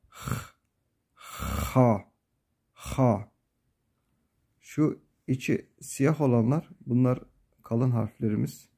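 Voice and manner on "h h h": H said as a rough scrape at the back of the throat; rasping kha. Shown as a demonstration. Teacher showing students the heavy rasping H, throaty. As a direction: scrape the H with a rasp. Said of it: "Bir hırıltı var".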